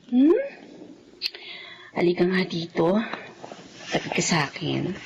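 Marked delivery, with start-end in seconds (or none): 1.9-5.0 much lower, soft soothing voice; mother coaxing child to sleep; tender. Acted.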